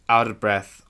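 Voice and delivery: plain reading voice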